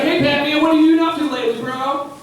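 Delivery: high, friendly panhandler catching up; eager buddy voice. Retold or imitated. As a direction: panhandler chatting up the driver at the light. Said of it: "He's like".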